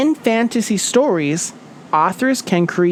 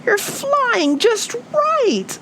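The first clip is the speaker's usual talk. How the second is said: much higher, slow and gushing with joy; Fluff, delighted; much slower than usual. A narrator's reading. Fluff praising Little Flap in flight, high joyful bird voice. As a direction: cheer him on like a proud little bird. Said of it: "said Fluff".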